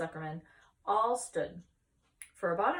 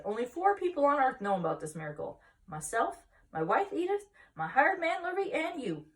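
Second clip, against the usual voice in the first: eager confiding; 8 semitones higher, 6 dB louder than usual. Acted. Farmer telling minister the secret.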